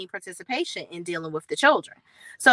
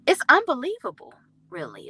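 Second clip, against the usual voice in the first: incredulous; 6 semitones higher than usual. Real, not acted. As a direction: throw up your hands in disbelief. Disbelief at Jennifer.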